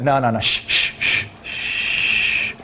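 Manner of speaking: soft, hissed shh to silence her; belligerent shushing. Shown as a demonstration. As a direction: shush her like you own the conversation. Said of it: "Very belligerent, very commanding".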